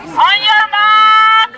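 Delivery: slow, loud drawn-out call; starter's shout. Real, not acted. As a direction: call the start across the field. Starting a kids' race.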